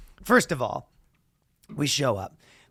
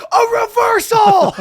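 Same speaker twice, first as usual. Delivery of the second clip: very high, loud shouted call; announcer calling out a puke, very loud; 9 dB louder than usual. Retold or imitated. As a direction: yell it like a big sports moment. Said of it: "the announcer goes".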